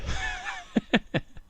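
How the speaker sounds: high, breathless giggle; real laugh. Real, not acted. Comic laughing at own mockery, real high giggle. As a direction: crack up at your own bit. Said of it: "I'm laughing at myself".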